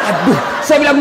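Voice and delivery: normal talking voice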